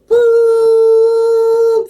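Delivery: high boop. Pretend. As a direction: pop it out like a cartoon bleep. Sound effect while handling crystal.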